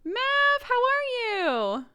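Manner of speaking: light up at a friend in chat; excited greeting. Real, not acted. Greeting a chatter by name.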